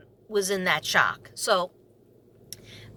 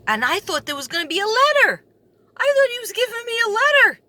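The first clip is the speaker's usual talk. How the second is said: high, loud, rising excitement; excited outburst; 12 semitones higher, 6 dB louder than usual. Real, not acted. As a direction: blurt it out loud at a high pitch. Excited thought about a letter.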